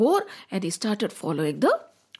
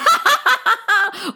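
loud high evil cackle; escaping demons' wicked laugh; demonic laughter; 10 semitones higher, 7 dB louder than usual. A narrator's reading. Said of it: "there was a laughter".

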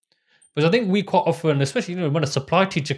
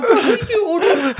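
much higher and louder, words pushed out through laughter; laughing through words; 5 dB louder than usual. Real, not acted. Quoting his retort to his brother, laughing hard. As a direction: squeak it out while cracking up.

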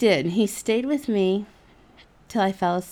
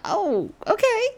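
coy okay; 13 semitones higher than usual. Retold or imitated. Coy reply.

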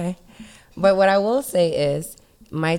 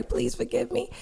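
whispered apology; 13 semitones higher, 8 dB quieter than usual. Real, not acted. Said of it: "I'm sorry, Haiti".

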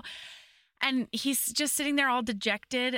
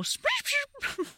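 very high tweeting bird voice, breaking into laughs; chirping bird; much slower than usual. Retold or imitated. Imitating the bird on the caravan, high chirps with laughter. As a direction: squeak it like a little bird, laughing. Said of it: "the bird go".

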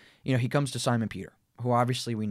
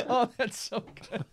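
laughing; 12 semitones higher than usual. Real, not acted. Laughing at the mix-up.

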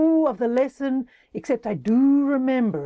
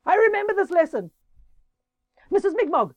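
higher and louder, quick warning; urgent higher cry of stop; 11 semitones higher, 6 dB louder than usual. Acted. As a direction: shout a warning to stop her. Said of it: "Horace who said".

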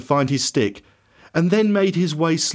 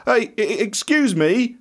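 higher, hesitant call to passers-by; 7 semitones higher than usual. A narrator's reading. Blind beggar asking the passing crowd, calling out. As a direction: call out for someone's attention. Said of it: "he called out".

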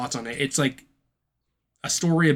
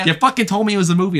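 fast, louder, higher accusation; loud accusing; 7 semitones higher, 6 dB louder, faster than usual. Real, not acted. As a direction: call him out, voice raised. Accusing friends, heated.

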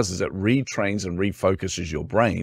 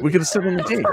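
"deck" with a Kiwi vowel, said higher; Kiwi accent; 10 semitones higher, faster than usual. Retold or imitated. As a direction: repeat it in a New Zealand accent, innocently. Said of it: "The accent gets them every time".

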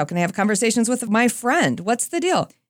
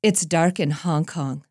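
deadpan; 4 semitones lower, slower than usual. Retold or imitated. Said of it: "with a deadpan face".

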